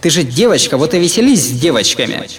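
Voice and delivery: irritated outburst. Acted. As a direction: lash out, can't stop the words. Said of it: "в раздражении, а ещё, наверное, в страхе".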